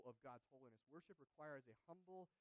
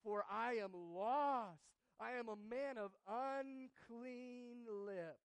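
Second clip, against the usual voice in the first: slow, loud, higher lament; despairing confession; 8 semitones higher, 15 dB louder, much slower than usual. Acted. Isaiah confessing his sinful lips, slow loud anguish. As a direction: drag each word out in despair. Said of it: "He says".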